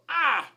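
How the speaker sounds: sharp high gasp "ah"; high startled yelp; startled flinch. Retold or imitated. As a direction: yelp as if just poked. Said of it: "you would normally go".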